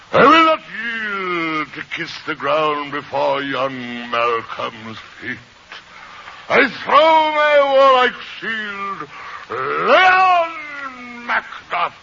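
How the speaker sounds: grand Shakespearean stage declamation; booming defiant Macbeth. Pretend. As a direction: boom it out like a defiant warrior. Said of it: "show B B, show him Macbeth".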